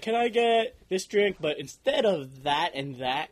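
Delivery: slow, drawn-out customer order; string the order out in a mock customer voice; exaggerated, dragging drink request; fussy customer.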